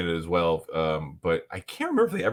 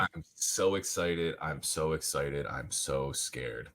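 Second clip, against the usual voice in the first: slow, soft mock melodrama; quiet mock Jessie; 6 semitones lower, 9 dB quieter, slower than usual. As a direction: drawl it out softly, mocking. Echoing the clip's line.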